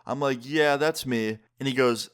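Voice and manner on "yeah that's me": slow, drawn-out YEAH in "Yeah, that's me"; reluctant admission. Retold or imitated. Admitting the video to girlfriend's shocked dad, slow and reluctant. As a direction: own up slowly, stretching the words.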